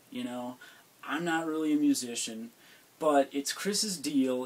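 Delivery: ordinary talk, nothing marked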